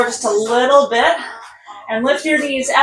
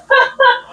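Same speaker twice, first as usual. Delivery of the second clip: short laugh; 13 semitones higher, 4 dB louder than usual. Real, not acted. Laughs before sprint countdown.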